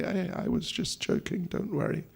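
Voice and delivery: hushed, lightly acted reassurance; soft reassurance. Husband soothing his fainted wife after a joke, soft reassurance. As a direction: reassure under your breath.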